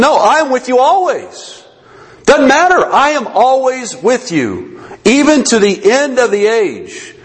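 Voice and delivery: high, slow, insistent promise; emphatic assurance. Acted. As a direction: proclaim it with total conviction. Christ's emphatic answer to the hedged version.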